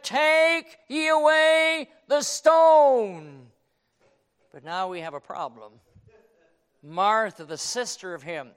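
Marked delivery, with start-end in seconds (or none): 0.0-3.5 very slow, loud, high command; 'Take ye away the stone' drawn out with authority; commanding Jesus. Acted. Preacher voicing Jesus ordering the stone removed, slow and commanding.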